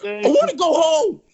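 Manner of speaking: mock despair through laughter; laughing whine. Real, not acted.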